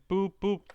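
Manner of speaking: two high, loud spoken beeps; high-pitched mimicked connection tone; imitated beeps. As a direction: mimic call tones, high and punchy.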